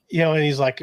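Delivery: normal talking voice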